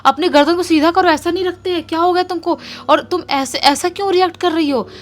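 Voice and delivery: startled man pleading to stop; alarmed scolding. Retold or imitated. Husband shouts at the girl to straighten her neck, alarmed.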